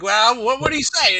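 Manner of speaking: Gary Busey impression. Friend pitching an impression act, as Gary Busey. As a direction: bark it like a manic Busey. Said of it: "Gary Busey at the D M V".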